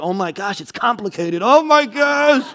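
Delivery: slow gushing Oh my gosh; mock gossip gasp. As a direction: play a gossip gasping over the news. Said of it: "God's not following your relationship status like".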